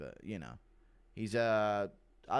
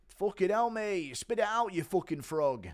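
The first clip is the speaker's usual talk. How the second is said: higher, louder bullying Mancunian jeer; Tom Aspinall impression; 8 semitones higher, 4 dB louder than usual. Bullying Cyril Gane at a press conference, loud taunting jeer. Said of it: "Impression of Bully Tom Aspinall".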